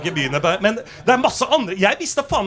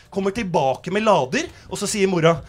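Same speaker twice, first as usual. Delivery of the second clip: ordinary talk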